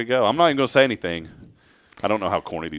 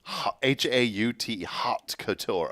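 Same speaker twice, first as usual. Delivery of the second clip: slow careful spelling; 7 dB quieter, slower than usual. Shown as a demonstration. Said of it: "What is that?".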